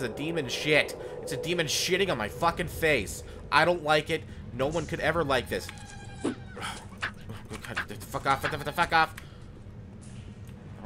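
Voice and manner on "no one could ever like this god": usual speaking voice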